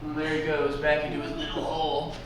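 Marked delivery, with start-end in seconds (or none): none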